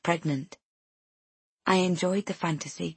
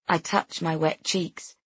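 usual speaking voice

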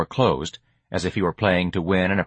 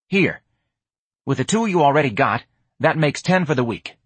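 put-on higher street-kid voice; pitched-up, matter-of-fact deal; streetwise hustler, dealing; 6 semitones higher than usual. George pays the week's rent, street voice.

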